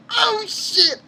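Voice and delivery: a real, high yelp of surprise; shocked. Real, not acted. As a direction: blurt it out, caught off guard. Shocked reaction to a plot reveal.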